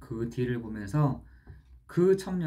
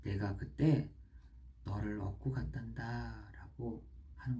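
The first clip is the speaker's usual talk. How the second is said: tender voice of the Lord; 3 semitones lower, 6 dB quieter than usual. Acted. God gently reassuring the weary young man, soft and tender. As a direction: comfort him gently, like a quiet voice within. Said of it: "그때 주의 음성이 들렸어요".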